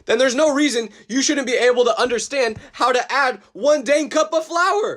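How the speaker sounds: exasperated rant. Acted. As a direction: build to a frustrated high-pitched outburst. Climax of cooking rant.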